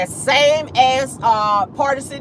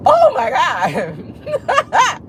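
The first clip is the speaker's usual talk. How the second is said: laughing; 6 semitones higher, much slower than usual. Real, not acted. Laughing at chat.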